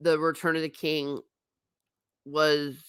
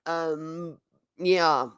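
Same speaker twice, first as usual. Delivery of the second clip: normal talking voice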